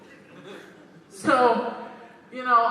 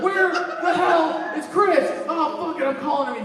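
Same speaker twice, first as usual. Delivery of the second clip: frantic friend; 7 semitones higher, 3 dB louder than usual. Pretend. Friend Alkali frantic searching.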